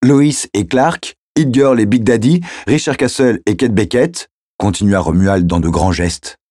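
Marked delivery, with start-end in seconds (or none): 0.0-4.3 higher, slow, relishing each name; a kid showing off his heroes; gleeful teen listing. Acted. (ado qui énumère, plus aigu et lent)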